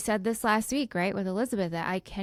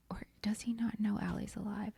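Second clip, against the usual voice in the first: whispering; 10 dB quieter than usual. Real, not acted. Whispered aside about the plot.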